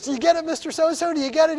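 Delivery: high, quick, insistent questioning; eager prodding. Retold or imitated. Pastor voices Steve testing the bus driver, high eager prodding.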